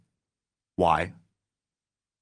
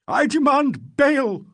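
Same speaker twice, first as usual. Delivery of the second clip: much higher, louder and slow, trembling; trembling cry; 7 dB louder, much slower than usual. Acted. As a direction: demand it with a shaking voice. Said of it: "cried Legg in a trembling voice".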